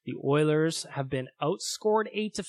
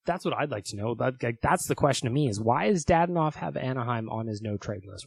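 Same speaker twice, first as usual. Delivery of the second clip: ordinary talk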